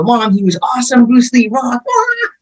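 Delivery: put-on high, bubbling kid's excitement, ending in a squeal; excited kid. Kid gushing to Mom about Bruce Lee, excited and high. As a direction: gush like a thrilled nine-year-old. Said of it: "I'm super excited".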